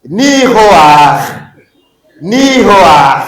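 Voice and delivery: loud, drawn-out "Ni Hoach" with guttural final ch; pronunciation model. Shown as a demonstration. Teacher has students follow closely, loud model "Ni Hoach". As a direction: project "Ni Hoach" firmly, a before the ch. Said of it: "Maintenant, suivez bien".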